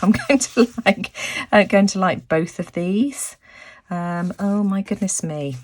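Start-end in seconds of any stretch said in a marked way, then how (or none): none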